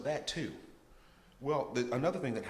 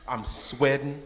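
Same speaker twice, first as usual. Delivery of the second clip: ordinary talk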